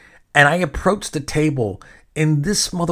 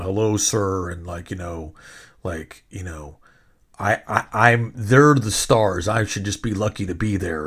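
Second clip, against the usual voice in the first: a hint of a mocking standoffish promoter, lower; 6 semitones lower than usual. Host mocking the stiff promoter who snubbed him. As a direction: sneer it out like a stiff, dismissive gatekeeper. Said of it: "real standoffish and was acting like".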